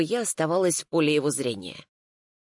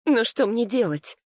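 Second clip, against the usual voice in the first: higher, mildly anxious plea; voice faltering; 7 semitones higher than usual. A narrator's reading. Client asks her lawyer what to do, voice faltering. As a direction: ask with a touch of helpless tremor. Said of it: "Мой голос невольно дрогнул".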